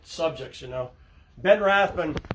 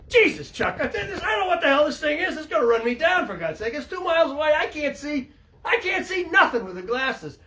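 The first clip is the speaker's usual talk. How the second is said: panicked captain; 9 semitones higher than usual. Retold or imitated. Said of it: "He says".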